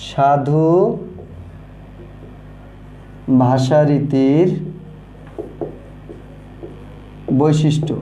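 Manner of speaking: সাধু ভাষারীতির বৈশিষ্ট্য dictated slowly, word by word with long pauses; drawn-out words for students to copy; slow dictation. Shown as a demonstration. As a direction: spell it out slowly while writing on the board. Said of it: "আমরা একটু লিখি যে".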